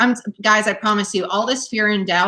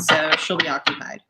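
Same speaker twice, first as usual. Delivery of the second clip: ordinary talk, nothing marked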